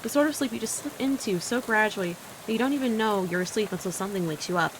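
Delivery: normal talking voice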